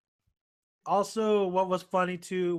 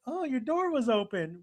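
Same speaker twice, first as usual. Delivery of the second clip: light, higher voice, falling at the end; innocent answer; 6 semitones higher than usual. Retold or imitated. Mihoshi explains to Washu how she got in, innocent answer. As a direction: imitate an artless explanation.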